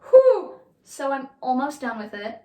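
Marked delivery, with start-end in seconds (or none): 0.1-0.6 whew. Real, not acted. Exhale before starting new topic.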